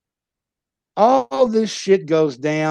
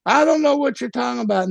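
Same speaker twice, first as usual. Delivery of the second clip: higher, fast feigned innocence; playing dumb; 7 semitones higher, faster than usual. Husband feigning innocence to his furious wife, quick and high.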